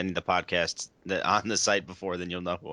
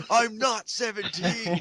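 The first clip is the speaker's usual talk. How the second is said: put-on stoned teen voice; 12 semitones higher, much slower than usual.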